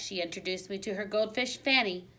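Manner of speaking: ordinary delivery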